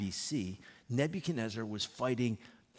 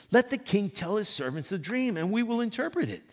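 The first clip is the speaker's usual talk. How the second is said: higher, louder, hurried plea; pleading astrologers; 8 semitones higher, 5 dB louder than usual. Acted. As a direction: beg the king quickly, voices rising.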